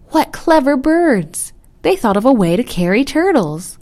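high, loud, delighted exclamation; admiring child. A narrator's reading. Child admires the clever birds, excited. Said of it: "Another child chimed in".

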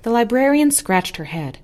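plain reading voice

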